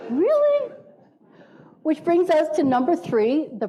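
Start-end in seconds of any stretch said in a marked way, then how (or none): 0.1-0.7 really pitched much higher, laughing; amazed really. Shown as a demonstration. Scene: speaker jokes humans aren't so bad, amused wonder. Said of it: "We are really not so bad".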